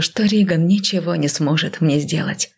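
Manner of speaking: usual speaking voice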